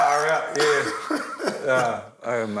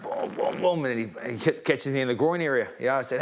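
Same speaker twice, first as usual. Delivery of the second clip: ordinary delivery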